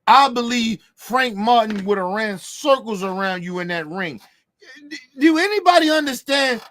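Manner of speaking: ordinary talk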